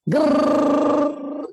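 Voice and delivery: imitated machine noise. Teacher showing the machine delivering energy, imitated machine noise. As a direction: whirr like a motor at work.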